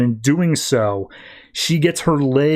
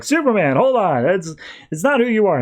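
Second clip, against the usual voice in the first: higher, urgent mock plea; pleading Lois; 7 semitones higher than usual. Lois pleads with Superman to stop, urgent and higher. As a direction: beg him to stop, pitched up. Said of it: "And Lois is like".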